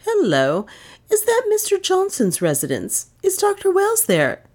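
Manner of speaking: high, questioning telephone voice; telephone manner. A narrator's reading. Aunt telephoning for the doctor, high enquiring voice. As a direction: enquire down the line, pitched well up.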